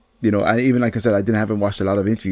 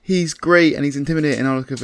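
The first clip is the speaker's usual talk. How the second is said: ordinary talk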